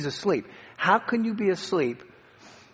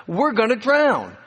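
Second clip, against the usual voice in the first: raised, higher cry for help; drawn-out, urgent plea, pitched up; retold scream; 5 semitones higher, 3 dB louder, slower than usual. Frightened disciples screaming to their Lord to save them, retold scream. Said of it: "they screamed out to him".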